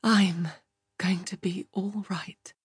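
true breathy whisper, no voice. A narrator's reading. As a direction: whisper it to reassure yourself. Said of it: "she whispered".

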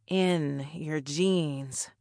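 slow, drawn-out repeat; pensive echo. Acted. Katrina echoing the doorman's words to herself, slowly and pensively. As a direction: let each word stretch as the thought sinks in.

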